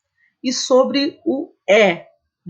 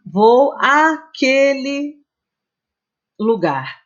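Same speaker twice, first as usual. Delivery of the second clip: "vou à praia" drawn out slowly, leaning on the "à"; slow demo; 5 semitones higher, much slower than usual. Shown as a demonstration. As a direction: spell out the example slowly for students. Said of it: "Por exemplo". (exemplo lido bem devagar, marcando a crase)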